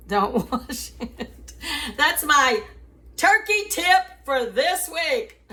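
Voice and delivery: can't keep a straight face; laughing. Real, not acted. Giving viewers her turkey tip, laughing.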